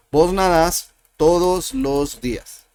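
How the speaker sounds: normal talking voice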